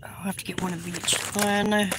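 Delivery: soft whisper to herself. Real, not acted. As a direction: breathe it out under the breath. Quiet aside while shopping.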